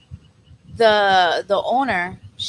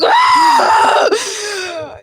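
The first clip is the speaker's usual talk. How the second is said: long, high, loud wail; frustrated scream; 7 dB louder than usual. Retold or imitated. Worker unable to get revenge, frustrated scream. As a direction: let out a drawn-out exasperated scream.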